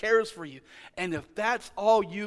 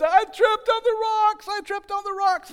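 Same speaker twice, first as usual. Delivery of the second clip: put-on crying little girl; 7 dB louder than usual. Preacher imitates his hurt daughter sobbing out what happened, in a high crying child voice. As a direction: squeak it out like a hurt little kid between sobs.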